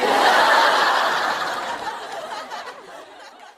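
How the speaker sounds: high, breathy laugh; loud laugh. Real, not acted. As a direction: let out a long cackle. Laughs after calling her brat.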